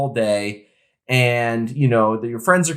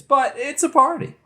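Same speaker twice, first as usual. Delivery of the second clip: excited; 12 semitones higher than usual. Real, not acted. Hyping up the festival.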